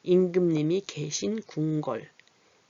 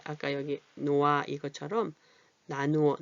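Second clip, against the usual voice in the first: ordinary delivery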